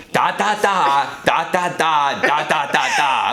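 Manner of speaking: high, exasperated mock repeat; angry. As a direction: spell it out in retold irritation.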